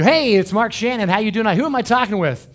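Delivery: chirpy late-night host greeting; mock radio host. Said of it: "we'd go".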